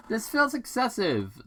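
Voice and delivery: dry quip. Acted. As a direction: toss off a wry remark. Sonic's quip.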